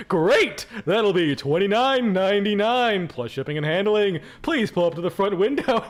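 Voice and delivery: punchy opener into showy host patter; big put-on host voice; talk show host. Shown as a demonstration. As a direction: announce the price like a prize on TV. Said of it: "put on talk show host voice".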